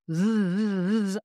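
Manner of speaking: a long steady voiced zzz buzz; bee-like buzzing zzz. Shown as a demonstration. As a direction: hold a buzzing Z sound from the belly. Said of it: "Just do a few Zs".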